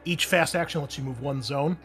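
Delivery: usual speaking voice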